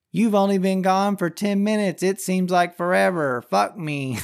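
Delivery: a hint of a breathy robot girlfriend; sex robot voice. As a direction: play a clingy talking robot. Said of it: "it's like".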